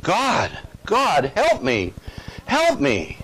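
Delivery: mildly acted plea for help; mock crying out. Man fallen down a cliff calling to God, mock crying out. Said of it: "he called out and cried out".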